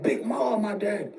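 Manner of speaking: high-pitched, indignant protest without shouting; imitating an angry son. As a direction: act out outraged disbelief at your mother. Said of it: "Like, he got mad".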